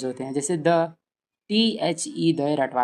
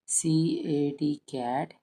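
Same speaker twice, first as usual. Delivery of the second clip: slow spelling drill; 5 dB quieter, much slower than usual. Shown as a demonstration. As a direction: spell it slowly letter by letter. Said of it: "ऐसे line से लिखवाइए".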